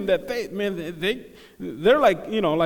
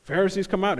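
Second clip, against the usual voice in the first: ordinary delivery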